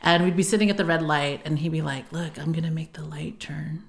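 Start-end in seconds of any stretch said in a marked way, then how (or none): 2.1-3.8 low-volume, whispery aside; hushed retold dad voice; imitating dad's trick setup. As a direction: half-whisper it like a secret about to be shown. Acting out dad's secretive trick.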